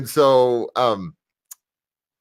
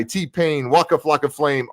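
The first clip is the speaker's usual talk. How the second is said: ordinary talk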